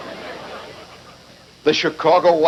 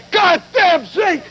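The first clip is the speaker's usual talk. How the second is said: slow, much higher, louder mock shout; father's angry curse; 11 semitones higher, 4 dB louder, much slower than usual. Father snagging his shoe, mock furious shout. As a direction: bellow it like a dad tripping on the floor strip. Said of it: "He'd say".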